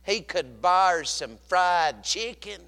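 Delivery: slow, loud Southern drawl; exaggerated Southern twang, stretched out slowly; Southern boy. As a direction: imitate a little boy's drawn-out Southern accent. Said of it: "He's from the South".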